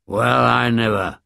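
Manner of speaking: a hint of a puzzled papa bear growl; lightly gruff bear, bemused. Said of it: "growled Daddy Bear".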